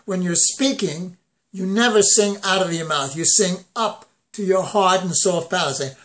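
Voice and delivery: ordinary delivery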